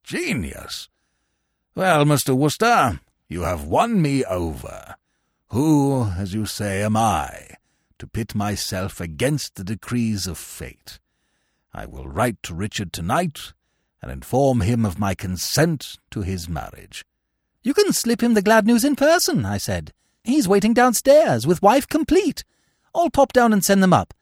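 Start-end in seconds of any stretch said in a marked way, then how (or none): 0.0-0.9 put-on murmuring old man. A narrator's reading. Old Bittlesham repeating his praise, softly awed. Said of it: "murmured old Bittlesham". 1.7-17.1 put-on low, slow, stately old man; won-over old uncle. A narrator's reading. Old uncle granting consent to a marriage, low and stately. 17.6-19.4 put-on high, chirpy young gentleman. A narrator's reading. Bertie reporting to the uncle, high and breezy.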